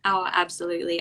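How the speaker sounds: ordinary talk, nothing marked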